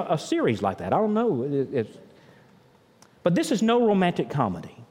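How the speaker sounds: ordinary talk, nothing marked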